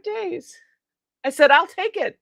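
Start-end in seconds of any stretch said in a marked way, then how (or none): 1.5-2.2 high, louder, delighted agreement; replayed grateful eagerness; retold eager acceptance. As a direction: jump at the offer.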